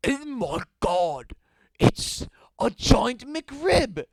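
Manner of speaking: loud, very slow cry of awe, rising high; hammed-up cry. A narrator's reading. Civilian seeing a flying McRib, hammed-up cry. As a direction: draw out each word in mock amazement. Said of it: "cried one of the civilians below".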